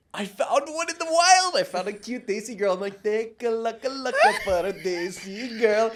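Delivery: high, loud and gleeful; thrilled at a lucky find; excited. Retold or imitated.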